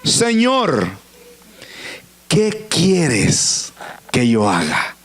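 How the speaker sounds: slow plea sinking low; hushed-pitch, drawn-out surrender; fearful, humbled. Acted. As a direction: ask slowly, dropping into awed submission. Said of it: "temblando y temeroso dijo".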